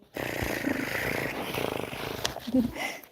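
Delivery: long, voiceless rolled purr; imitated cat purr. Showing how Sophie purred nonstop, imitated purring. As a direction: trill a constant little purr. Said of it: "Just a constant little".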